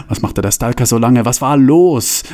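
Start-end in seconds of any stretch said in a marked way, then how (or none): none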